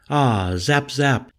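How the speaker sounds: lightly crazy chant-like delivery; slightly crazy intoning. A narrator's reading. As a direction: intone it, a touch unhinged. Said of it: "Bernardo intoned, seeming just slightly crazy".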